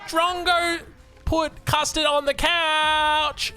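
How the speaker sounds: very high, drawn-out mock screech; imitated scream. Little sister tells on her brother, screamed imitation. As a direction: tattle at the top of your lungs, slowly. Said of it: "what she screams".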